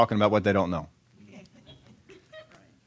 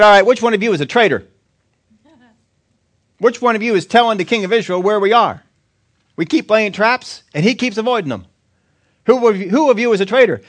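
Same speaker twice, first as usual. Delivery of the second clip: angry king; 8 semitones higher, 6 dB louder than usual. Acted. King demands who betrayed him.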